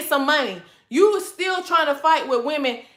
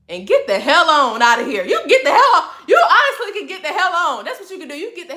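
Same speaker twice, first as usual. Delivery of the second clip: laughing dismissal; 5 semitones higher, 6 dB louder than usual. Real, not acted. Laughing dismissal of a broke man.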